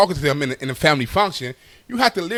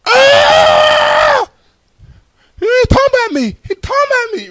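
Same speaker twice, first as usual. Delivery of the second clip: very high, loud wailing complaint; upset aunt; 6 dB louder than usual. Retold or imitated. Deaf aunt running to the family authority, high wailing complaint.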